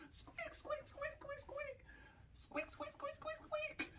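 tiny high squeaking noises; high soft mouse squeak. Shown as a demonstration. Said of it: "Not sure my squeaks are very convincing".

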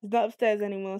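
usual speaking voice

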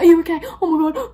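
loud, high, flustered concern; mock alarmed passengers crowding round; alarmed strangers. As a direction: blurt it out in a worried fuss. Passengers again fussing loudly.

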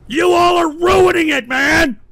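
very loud, high, accusing yell; furious shout. Real, not acted. Host blaming trolls for ruining his show, furious shout. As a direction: bellow it at the whole crowd.

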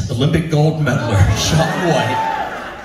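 usual speaking voice

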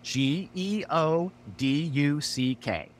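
slow, separate letters; spelling it out. Acted. [name] proving his point to Noah, slow and deliberate spelling. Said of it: "do I have to spell it out".

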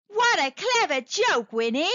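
high-pitched, amused exclamation; crying out. A narrator's reading. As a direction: call it out as a delighted crowd. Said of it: "they cried".

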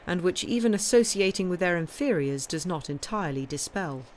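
normal talking voice

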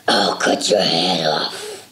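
very low, slow monster voice; menacing deep threat. Acted. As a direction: drop into a deep growling threat. Baker threatens mouse.

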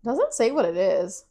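talking between bites; chewing while talking. Real, not acted. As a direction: mouth partly full. Seen 3: Bites cracker at -3; closed-lip chewing visible to 1.6.

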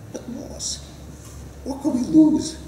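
high pleading little man; high character voice. Acted.

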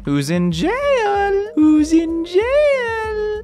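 very high, slow falsetto call; teasing singsong. Pretend. Player calls to the jail cells, slow high singsong. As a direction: stretch it out in a silly falsetto.

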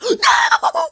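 gasp, then a shrieked high no; jolted by a jump scare, yelp it out; startled scream. Real, not acted. Gamer jumps at a zombie lunging, shrieks no.

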